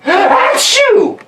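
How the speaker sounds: big gasping high sneeze; hammed-up elephant sneeze; acted sneeze. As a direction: gasp in, then explode high.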